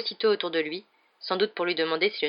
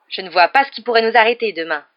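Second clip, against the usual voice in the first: louder, raised voice, confident exclaiming; exclamation; 4 semitones higher, 9 dB louder than usual. A narrator's reading. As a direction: exclaim it with higher pitch and force. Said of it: "s'exclama Dubois".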